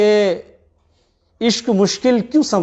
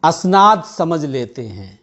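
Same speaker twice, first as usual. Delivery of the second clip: measured Urdu poetry recitation; tender romantic poetry; 4 semitones lower than usual. Acted. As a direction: recite each couplet with feeling. Poet reciting at mushaira.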